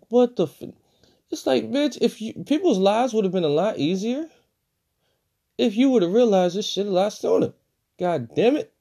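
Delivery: high, indignant outburst at the character; venting, fed up with her; exasperated rant. Real, not acted. Ranting at the mother in the movie, high and exasperated.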